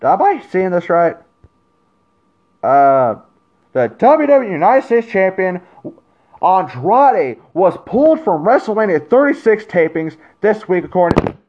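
high and loud while reading the news; shocked reading. Real, not acted. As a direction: read it out in disbelief. Reads shocking news aloud.

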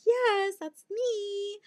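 very high, drawn-out squeak; mock fragile reply. Stranded driver to dealership guy, mock fragile. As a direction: answer in a tiny hammed-up squeal.

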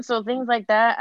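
ordinary talk

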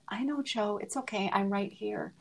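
a tender, quietly tearful goodbye; choked up. Real, not acted. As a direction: say it gently as emotion rises. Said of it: "I'm gonna get so, so emotional".

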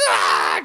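loud, rough imitated scream; imitated horror-movie shriek; mock freak-out scream. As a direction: burst out with a hammed-up "Ah!". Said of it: "Michelle Yeoh was freaking out".

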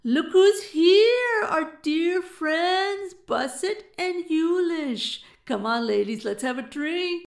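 loud, high, drawn-out call; whooping, stretched-out welcome, easing at the end; boisterous greeting. A narrator's reading. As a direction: hail old friends at full volume. Buck hails his friends on arriving.

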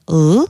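l dark, as in English "call"; l held at the back of the mouth; heavy English l; Albanian LL sound. Shown as a demonstration. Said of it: "comme call en anglais".

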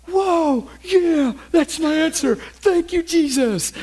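high-pitched acted outburst of joy; excited. Preacher acting out a churchgoer hearing their answer, excited. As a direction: act out a thrilled churchgoer. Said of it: "you get all excited".

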